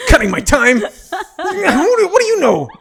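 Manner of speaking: high, loud, laughing indignation; fake-furious through laughter; laughing mock outrage. New comic laughing at the host for cutting his time.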